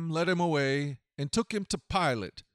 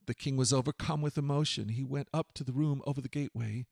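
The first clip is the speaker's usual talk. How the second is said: ordinary delivery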